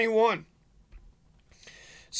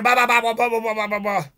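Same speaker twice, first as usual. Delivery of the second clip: imitated engine noise; faster than usual. Imitates loud rig's engine noise.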